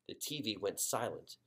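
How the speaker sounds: ordinary delivery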